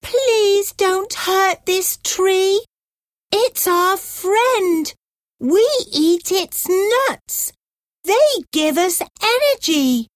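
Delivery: pleading monkey. A narrator's reading. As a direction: plead quickly like a little monkey. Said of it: "The monkey cried".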